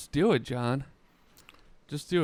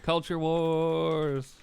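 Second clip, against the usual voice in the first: dramatic announcer; 4 semitones higher, much slower than usual. Acted. Mock dramatic title.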